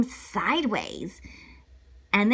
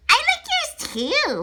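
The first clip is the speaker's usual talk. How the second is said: ordinary delivery, nothing marked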